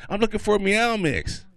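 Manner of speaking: put-on high, loud girlfriend voice; mad girlfriend. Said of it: "she was all mad".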